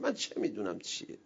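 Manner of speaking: ordinary talk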